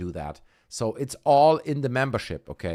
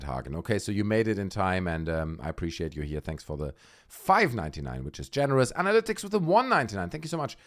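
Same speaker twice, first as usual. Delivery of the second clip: normal talking voice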